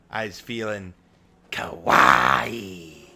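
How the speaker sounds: low, loud, silly shout; shouted kawaii. Pretend. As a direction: yell it like a goofy ork. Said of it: "She might say".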